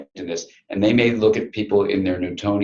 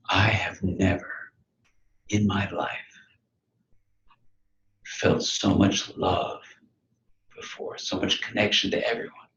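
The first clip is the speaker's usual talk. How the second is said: lightly voiced man close to crying, hushed; murmured through mild mock tears; retold tearful words; 5 dB quieter than usual. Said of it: "He starts to cry".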